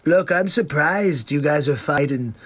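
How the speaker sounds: normal talking voice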